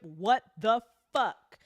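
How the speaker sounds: usual speaking voice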